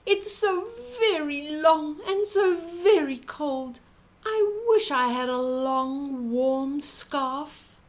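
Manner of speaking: sad giraffe. A narrator's reading. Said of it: "sniffling sadly".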